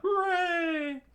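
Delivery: high, drawn-out crew cheer; screamed cheer. A narrator's reading. The crew raising steins together, screaming. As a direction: let out a long high hooray. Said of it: "screamed out".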